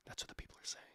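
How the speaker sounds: hushed conspiratorial whisper; breathless whispered aside. Acted. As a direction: whisper it like a secret.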